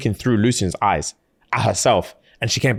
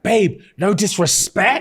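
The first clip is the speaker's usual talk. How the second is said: slow, much higher patronising opener; condescending; 9 semitones higher, slower than usual. Retold or imitated. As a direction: talk down to her slowly. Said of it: "and he's like".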